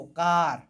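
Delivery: કાર slow and low, aa held; long aa sound. Shown as a demonstration. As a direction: draw out the aa in કાર. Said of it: "આ આવ્યો". (કાર માં આ લંબાવીને)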